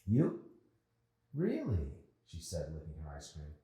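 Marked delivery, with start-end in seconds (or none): 0.1-2.0 put-on surprised girl. Mandy surprised.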